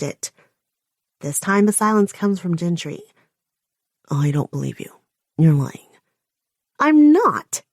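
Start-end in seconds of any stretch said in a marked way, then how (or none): 4.1-5.9 villain's low accusation. A narrator's reading. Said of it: "villain Gentry's accusation". 6.8-7.6 sharp loud denial, higher and louder; loud furious denial; loud outburst. A narrator's reading. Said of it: "how loud I've spoken".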